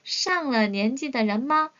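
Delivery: very slow 上了年纪的人吗 with a rising end; slow modelled question. Shown as a demonstration. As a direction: drill the question slowly for repetition. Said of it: "단독으로 가시면요".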